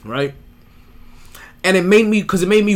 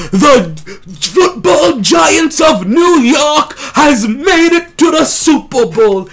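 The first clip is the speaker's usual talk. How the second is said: loud, slow proclaiming crier; town crier; 8 semitones higher, 7 dB louder, much slower than usual. Retold or imitated. Town crier announcing Giants win.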